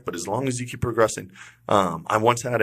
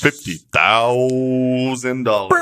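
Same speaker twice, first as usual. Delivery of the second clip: slow, drawn-out, louder game-show announcement; hyped prize announcement; 2 semitones higher, 5 dB louder, much slower than usual. Pretend. Host echoing the tournament prize to listeners, hyped announcement. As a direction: stretch it out big like an announcer.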